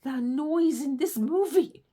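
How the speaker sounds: loud, slow, fed-up groan of a complaint; exasperated outburst. Retold or imitated. Reacting to the noisy movie, loud and exasperated. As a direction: blurt it out like the racket is too much. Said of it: "I was like".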